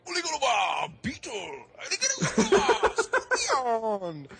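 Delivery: high, stretched announcer shout with laughter; mock boxing announcer. Imitating the bug-fight intro for friends, high stretched announcer call. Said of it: "They're like".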